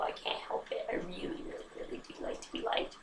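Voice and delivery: unvoiced, sheepish admission; whispered confession. Real, not acted.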